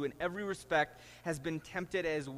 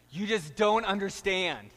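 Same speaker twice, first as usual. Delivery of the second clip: put-on louder, higher whiny teen protest; angsty teenager; 4 semitones higher, 6 dB louder than usual. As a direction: whine it like a sulky teenager. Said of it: "throes of adolescent angst".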